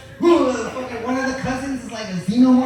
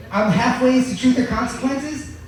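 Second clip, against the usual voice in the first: ordinary talk, nothing marked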